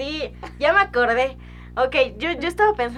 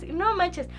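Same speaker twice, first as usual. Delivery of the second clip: normal talking voice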